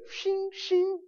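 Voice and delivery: high mock sword clash sound. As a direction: spear ringing sound effect, lightly mocked. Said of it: "walk around with spears".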